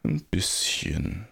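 slow, reluctant little confession; sheepish admission. A narrator's reading. The giant admits his fear, sheepishly. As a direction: own up slowly and meekly.

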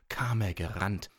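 plain reading voice

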